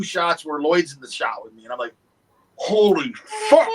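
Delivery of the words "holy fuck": loud, slow, stretched amazement; awed exclamation. Retold or imitated. Actor seeing photos of shots with Lloyd, loud drawn-out awe. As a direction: blurt it out slowly, stunned.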